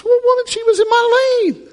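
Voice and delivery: very high, indignant mock protest; indignant man. Swerving man protesting to the woman, high indignant voice. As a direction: huff it out, offended. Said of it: "then he goes and says".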